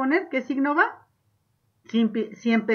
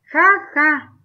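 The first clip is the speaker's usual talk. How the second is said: high, loud spoken ha ha; mock laugh; 5 semitones higher, 9 dB louder than usual. Shown as a demonstration. Teacher rereads the laugh with exclamation. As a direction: bark out a read laugh.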